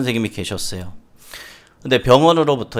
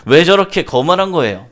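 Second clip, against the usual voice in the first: loud, raised-pitch indignant father; angry complaint; 6 semitones higher, 7 dB louder than usual. Acted. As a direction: protest to the nurse, easing off at the end. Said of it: "화를 내면서 막 이렇게 있었습니다". (간호사에게 화내며 큰 소리로 항의하듯)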